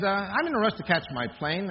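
usual speaking voice

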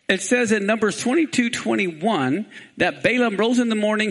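ordinary talk

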